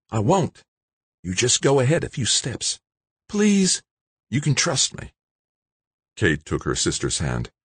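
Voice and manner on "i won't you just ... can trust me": high, earnest character voice; reassuring. A narrator's reading. George reassures two frightened women, earnest and high. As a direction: reassure them in a raised voice.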